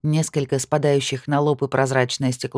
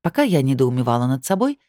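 ordinary delivery, nothing marked

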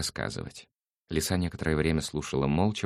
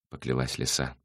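plain reading voice

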